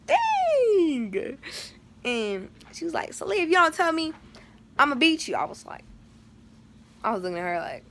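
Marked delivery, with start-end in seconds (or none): 0.1-1.4 very high, drawn-out whine; high mock-exasperated wail. Reenacting exasperation at mom. 3.1-5.4 high-pitched imitation of mom's threat; lightly hammed-up mom threatening.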